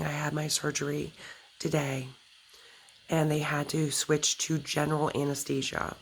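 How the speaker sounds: usual speaking voice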